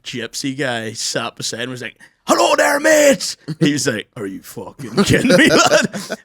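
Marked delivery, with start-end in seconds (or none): 2.3-3.4 loud, high, overfriendly stranger's greeting; big jolly shout of a stranger; booming hello. Retold or imitated. Loud stranger greets them on plane. 4.2-6.2 laughing disbelief. Retold or imitated. Disbelief at loud seatmate.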